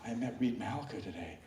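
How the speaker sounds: soft, fast, hushed awe; awed fan quote. Retold or imitated. Fan leaving, repeating his awe softly and fast. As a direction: say it under your breath, starstruck. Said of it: "And as he goes".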